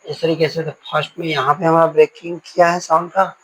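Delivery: normal talking voice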